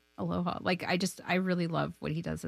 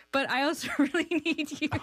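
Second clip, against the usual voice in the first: laughing plea; 9 semitones higher than usual. Retold or imitated. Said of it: "And I was like".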